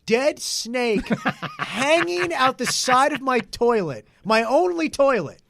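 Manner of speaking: very high, loud and slow; hammed-up outraged astonishment; incredulous rant. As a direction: stretch every word in exasperated disbelief. Acted disbelief at gullible brother.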